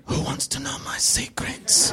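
slow, sinister stage whisper; hushed, drawn-out menace; creepy whisper. Acted. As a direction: whisper it slowly like a creepy villain. Acts creepy stranger turning round.

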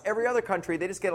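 normal talking voice

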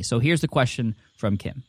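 ordinary talk, nothing marked

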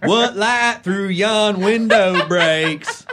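slow, drawn-out twangy Shakespeare line; mock Southern Shakespeare. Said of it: "this is not gonna work".

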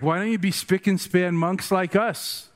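a touch of prim scolding, imitated; mock reproach. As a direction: lightly act out disapproving monks. Acting the monks' reproach.

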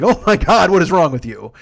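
mock outburst at a friend; imitated appalled friend. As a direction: play the friend scolding her, exasperated.

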